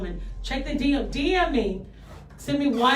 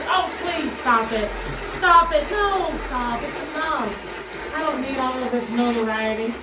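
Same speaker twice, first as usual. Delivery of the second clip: mock modesty; slower than usual. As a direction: pretend to wave off adoring applause. Mock modesty at imagined applause.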